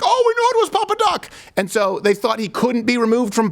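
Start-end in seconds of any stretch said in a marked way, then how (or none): 0.0-1.3 mock awed listener. The radio audience squeals high in mock awe. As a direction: gasp it out like a spooked believer. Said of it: "And be like".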